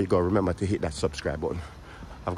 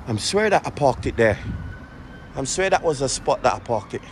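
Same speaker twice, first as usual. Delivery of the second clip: anxious high pleading; 6 semitones higher than usual. Real, not acted. Searching for lost car.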